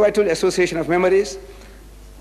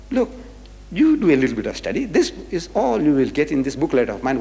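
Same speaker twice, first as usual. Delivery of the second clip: usual speaking voice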